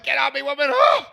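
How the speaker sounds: very high shrieking protest; hammed-up panic squeal; panicked shriek. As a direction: shriek to get her off you. Panicked pushing girl away.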